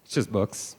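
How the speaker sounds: sudden low, soft letdown; deadpan anticlimax. Acted. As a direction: drop right down in pitch and volume. Anticlimax after shouted build-up.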